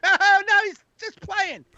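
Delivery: very high, fading flustered excuse; a dad smoothing over his kid's rudeness; mock embarrassed father. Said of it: "the father's like".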